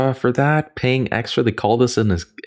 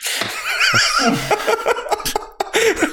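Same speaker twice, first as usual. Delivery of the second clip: laughing. Real, not acted. Laughs after his own bit.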